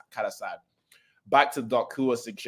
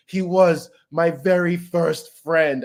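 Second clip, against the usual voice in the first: crying; 6 semitones higher, much slower than usual. Retold or imitated. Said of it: "as he's crying".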